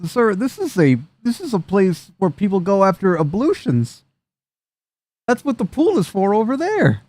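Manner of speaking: high, prim, lecturing voice; prim NPC. Acted. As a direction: correct them like a fussy attendant. NPC primly explains rules.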